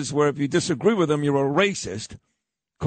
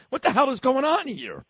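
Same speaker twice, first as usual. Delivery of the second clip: exasperated; 9 semitones higher than usual. Real, not acted. Exasperated outburst.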